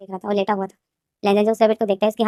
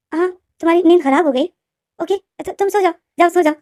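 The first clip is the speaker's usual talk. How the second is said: sudden sweetness; 7 semitones higher than usual. Acted.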